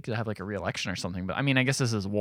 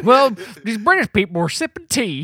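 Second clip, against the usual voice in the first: dumb American yokel; 10 semitones higher, 7 dB louder than usual. Pretend. Retelling history for co-host, mock yokel voice. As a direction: play a dim patriot telling history.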